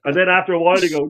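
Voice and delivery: usual speaking voice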